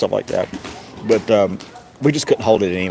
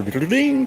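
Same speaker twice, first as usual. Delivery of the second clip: imitated startup chime. Computer startup sound imitated.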